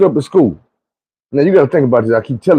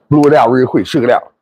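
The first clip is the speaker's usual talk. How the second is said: normal talking voice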